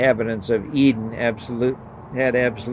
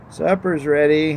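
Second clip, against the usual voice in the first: called out, higher and louder; calling out; 6 semitones higher, 3 dB louder than usual. A narrator's reading. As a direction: call it across the house. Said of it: "her stepmother called from the kitchen".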